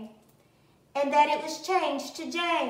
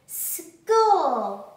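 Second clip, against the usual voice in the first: louder, bursting with excitement; a happy louder cry; eager exclamation; 3 semitones higher, 8 dB louder than usual. Acted. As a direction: shout it like the best news ever. Child runs happily to school.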